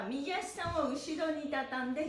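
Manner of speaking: usual speaking voice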